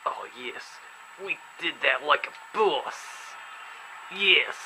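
lightly put-on Russian accent for his rider; a playful hint of a Russian accent; mock Russian accent. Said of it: "that's enough Russian accent".

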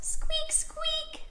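very high, soft squeak; little falsetto mouse voice; tiny mouse squeak. Pretend. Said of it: "tiny little squeak like a mouse".

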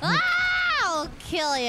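high playful yell; mock threat. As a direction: scream a pretend threat.

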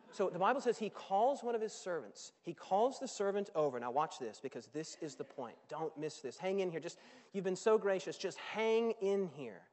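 usual speaking voice

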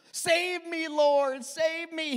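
high, pleading cry for help; desperate shout. A narrator's reading. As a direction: shout it like a man sinking. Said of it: "he shouted".

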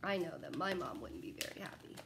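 ordinary delivery, nothing marked

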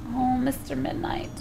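soft breathy whisper to the cat. Real, not acted. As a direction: whisper so nobody hears.

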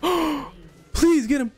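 high excited squeal. Real, not acted. Dying gamer squealing for his teammate to kill the enemy, high and excited. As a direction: squeal for a teammate to save you. Desperate plea, then relief.

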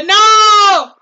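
high, loud cry of no; shocked no. Retold or imitated. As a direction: cry out in disbelief. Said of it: "y dije". (grito agudo y fuerte de incredulidad)

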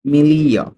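"মিলিও" with the g silent, a drawn-out "li"; teacher's model "মিলিও", g left unsounded; silent-g pronunciation. Shown as a demonstration. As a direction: say "মিলিও" gliding from "li" to "o", no hard g. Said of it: "জি এর উচ্চারণটা হবে না".

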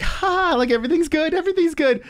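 very high imitated women's voice; mock cheery relief. Women getting out after the quarrel, high mock reassurance. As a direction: put on a high, everything-is-fine voice. Mother and girl smoothing things over.

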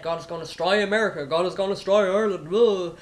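fast, mildly hammed-up prophecy of doom; imitating an alarmist preacher; mock doom-mongering. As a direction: rattle off the threats dismissively, ending in a loud jeer. Mimicking legalistic doom preachers.

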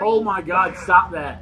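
loud, fed-up protest; exasperated outburst. Real, not acted. Player fed up with the game, exasperated outburst. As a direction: raise your voice in exasperation.

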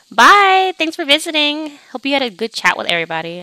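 loud, high, singsong goodbye; called-out farewell. Pretend. Host thanking a departing visitor, called-out farewell. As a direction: call it out brightly after a guest.

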